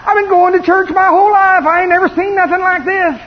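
loud, high-pitched amazement; acted-out awestruck outburst; astonished churchgoer. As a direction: exclaim it like a stunned congregation member.

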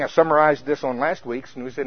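ordinary talk

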